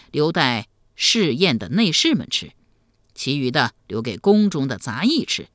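low, patient elder's voice; deep avuncular explanation; kindly emperor uncle. Acted. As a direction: explain calmly as a ruler to a boy.

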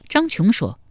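plain reading voice

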